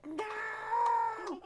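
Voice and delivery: put-on very high defiant little girl cry; high child's shout of no; brave sisters. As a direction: refuse in a tiny high voice. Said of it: "and they said".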